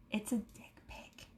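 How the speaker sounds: barely voiced whisper; confiding, scandalized whisper; hushed reveal. Real, not acted.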